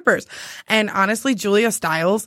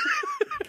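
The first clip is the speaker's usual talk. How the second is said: high squealing laugh; 9 dB quieter than usual. Real, not acted. Laughing at herself.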